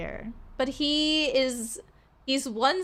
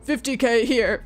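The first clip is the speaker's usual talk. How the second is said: stern father; 6 dB louder than usual. Acted. Stern letter.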